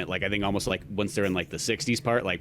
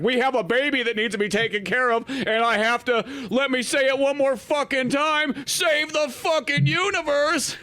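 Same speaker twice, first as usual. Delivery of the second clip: much higher, slowing to a drawn-out exasperated yell; exasperated Reed; 13 semitones higher than usual. Retold or imitated. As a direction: lose your patience, stretch the last words out. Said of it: "it's like".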